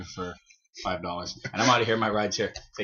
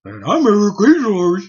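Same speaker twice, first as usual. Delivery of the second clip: louder, higher, slow put-on host voice; mock announcer; 7 semitones higher, 8 dB louder than usual. Cohost jokingly signing off under a fake name, mock announcer. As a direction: ham up the sign-off as someone else. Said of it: "mock sign-off as Eric".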